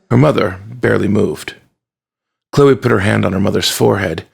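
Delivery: ordinary delivery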